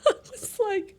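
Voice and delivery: high, breaking laugh through tears; laugh-crying. Real, not acted. Telling viewers her embarrassing moment, laugh-crying. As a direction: laugh and cry at once, embarrassed. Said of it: "laugh cry".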